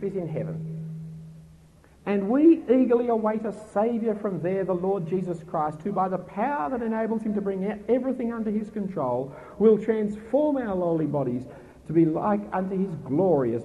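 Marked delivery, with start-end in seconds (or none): none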